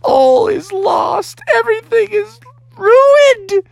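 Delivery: high, slow, tearful mock wail; melodramatic despair. Bear mourning his lava lamp, hammed-up wailing. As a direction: cry it out like all hope is gone.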